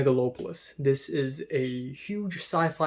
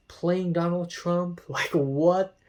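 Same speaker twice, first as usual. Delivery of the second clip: ordinary talk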